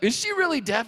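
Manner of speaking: high, loud disbelieving outburst; incredulous question. Retold or imitated. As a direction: demand it in hammed-up shock. Acted shock, questioning her brother.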